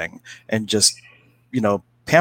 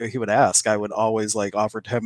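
usual speaking voice

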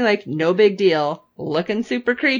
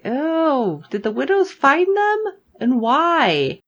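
higher, rising worried questions; mock alarm, pitched up; alarmed questioning; 6 semitones higher, slower than usual. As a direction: replay a worried viewer's reaction. Acting out own alarmed reaction.

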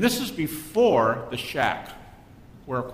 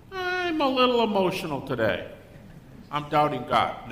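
higher, mock-sincere admission; mock confession; 5 semitones higher than usual. A guy admitting emotional doubt, mock-sincere. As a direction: lightly play a vulnerable believer. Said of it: "they don't do this either".

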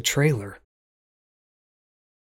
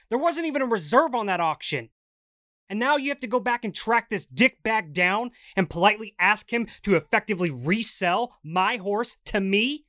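heated, higher pitched fury; angry rant; 8 semitones higher than usual. Acted. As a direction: rant in disbelief. Continues furious rant.

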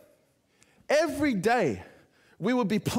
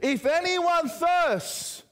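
high, drawn-out public cry; loud proclamation; 10 semitones higher, much slower than usual. Acted. Preacher voicing Jesus standing up at the festival, loud proclamation. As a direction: call it out high over a crowd, stretching the words. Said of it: "he just says in a loud voice".

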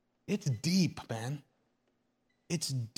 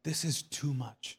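overwhelmed; 4 semitones lower, 9 dB quieter than usual. Acted. Believer worn down by serious loss, quietly overwhelmed. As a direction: let the voice sink under the weight. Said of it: "at times you're like".

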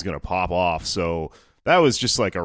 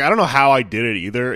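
usual speaking voice

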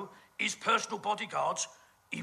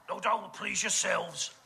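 normal talking voice

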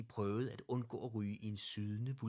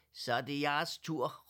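loud, higher shout to the group; calling out; 5 semitones higher, 7 dB louder than usual. A narrator's reading. Herr Ellegaard handing the lead to the teddies, calling out. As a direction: call the others forward. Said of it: "råbte Herr Ellegaard".

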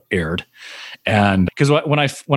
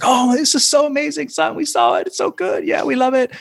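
much higher, gushing immigrant dad; excited dad imitation. Dad calling his son late at night, high excited gushing. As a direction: gush like a thrilled dad with a heavy Chinese accent. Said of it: "my dad is freaking out and he's like".

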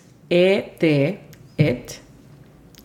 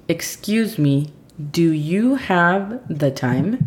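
slow, careful English phrase for learners; slow model phrase; much slower than usual. Shown as a demonstration. Teacher slowly models the phrase for Aime. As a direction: spell out each word slowly for the student. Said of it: "La tercera frase del día sería la siguiente".

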